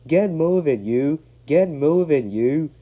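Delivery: high, loud puffing command; puffing shout. Acted. Douglas pushing from behind, puffing shouts. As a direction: shove the trucks with each shout. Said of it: "puffed Douglas from behind".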